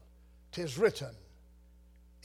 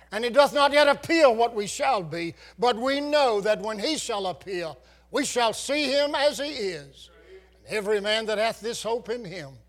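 preaching scripture; 2 semitones higher, 7 dB louder than usual. Acted.

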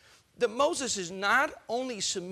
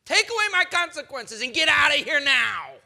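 louder, higher bossy demand; mock demand; 6 semitones higher, 8 dB louder than usual. Preacher voicing Pharaoh's demand, louder. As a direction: bark it out like a tyrant.

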